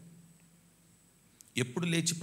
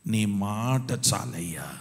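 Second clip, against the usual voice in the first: loud, slow prayer; fervent prayer; 3 semitones lower, 7 dB louder, much slower than usual. Acted. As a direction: lean into it. Prayer sung out. (గట్టిగా ప్రార్థన)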